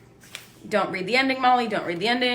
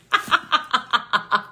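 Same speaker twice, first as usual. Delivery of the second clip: laughing at own bad luck; helpless string of ha-ha laughs; exasperated laugh. Real, not acted. Book turns out to be sequel.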